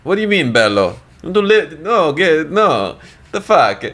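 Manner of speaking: high, exaggerated Italian accent, stretched out at the end; Italian coworker impression. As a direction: ham up an Italian guy in disbelief. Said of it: "And he was Italian".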